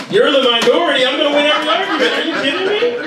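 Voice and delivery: loud, high, mock-outraged retort; triumphant comeback. New father turning girlfriend's boast on her, triumphant comeback.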